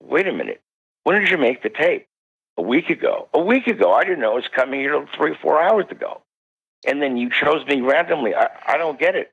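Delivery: rattled, can't make sense of it; agitated disbelief. Retold or imitated. Zorro's daughter to the researchers, high and agitated. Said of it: "And she said".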